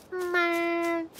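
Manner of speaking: high, soft disappointed whine; high whiny groan. Real, not acted. Lost resources after going down.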